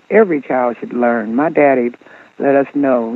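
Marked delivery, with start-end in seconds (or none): none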